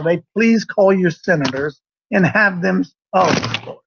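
normal talking voice

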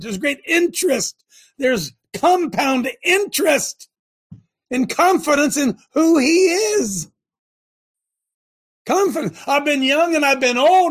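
loud, high, fiery preaching. Real, not acted. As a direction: preach it with full force. Preaching at full force.

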